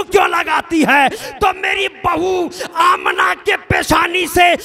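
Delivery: impassioned preacher building to a cry; fiery preaching. Acted. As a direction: preach it out to the crowd. Preacher narrates at full force.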